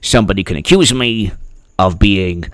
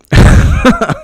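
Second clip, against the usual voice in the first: high giggle. Real, not acted. High laugh.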